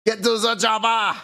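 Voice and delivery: over-the-top action hero shout; Arnold Schwarzenegger impression. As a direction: shout the movie line like a muscle-bound action star. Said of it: "Arnold Schwarzenegger".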